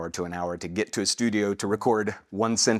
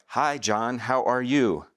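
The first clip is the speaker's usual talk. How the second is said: bored studio read; 4 semitones higher, 5 dB louder than usual. Retold or imitated. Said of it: "I was just getting so bored".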